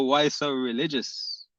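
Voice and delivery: slow, drawn-out teasing question; mocking tease. Real, not acted. Teasing a chat debater after a laugh, slow and mocking. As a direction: tease him slowly, amused.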